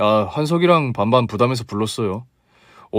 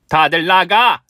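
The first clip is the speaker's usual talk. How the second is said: angry shout; 4 semitones higher, 4 dB louder, slower than usual. Acted. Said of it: "쌍욕을 하며 치우라고 하더군요".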